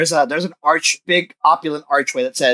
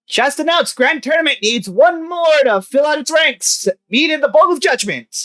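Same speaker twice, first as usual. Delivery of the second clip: town crier calling out; 13 semitones higher than usual. Acted. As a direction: call it out across the street like a herald. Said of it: "you hear him yelling out".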